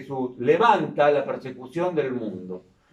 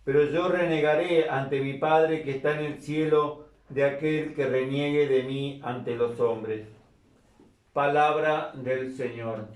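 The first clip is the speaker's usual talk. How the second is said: soft, measured scripture reading; liturgical reading; 5 dB quieter than usual. Acted. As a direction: read it like a priest at mass. Reads the gospel aloud.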